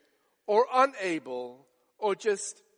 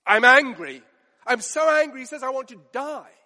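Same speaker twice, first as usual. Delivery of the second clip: louder and higher, building fury; angry outburst; 4 semitones higher, 5 dB louder than usual. Acted. Preacher voicing Jonah shouting at God, angry outburst. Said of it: "He said".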